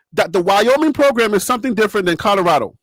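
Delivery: ordinary talk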